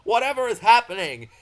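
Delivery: high, loud startled cry; alarmed cry. Acted. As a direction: cry out in shock. Trucks alarmed.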